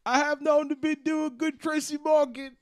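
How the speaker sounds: very high, hammed-up comedian voice; high celebrity impression; Tracy Morgan. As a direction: pitch it way up in a loose caricature. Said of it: "sounds a lot more like".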